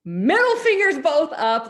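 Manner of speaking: high, loud exasperated burst; indignant venting; fed-up outburst. Retold or imitated. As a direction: blurt it out, fed up. Venting anger at the principal.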